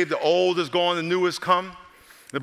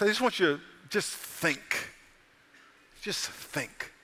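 hushed; 7 dB quieter, slower than usual. Acted. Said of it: "invites reflection".

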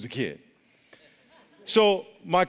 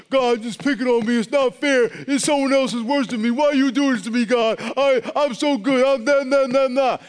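whiny complaint, higher and louder; mock whining; 7 semitones higher, 5 dB louder than usual. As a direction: whine like a spoiled child at God. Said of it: "We have a tendency to go".

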